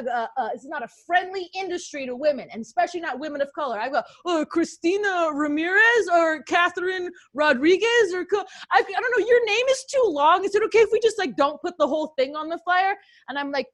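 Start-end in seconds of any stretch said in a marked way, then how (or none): 4.2-12.9 condescending promoter voice. Retold or imitated. Acted quote of condescending promoter.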